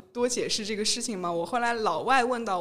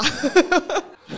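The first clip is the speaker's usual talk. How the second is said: loud bursting laugh; 7 semitones higher, 9 dB louder than usual. Real, not acted. Admitting her pressure, a loud laugh.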